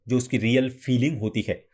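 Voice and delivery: ordinary talk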